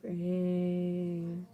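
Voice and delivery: low quiet zombie groan. Acted. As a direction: moan it like a zombie. Zombie mentioned.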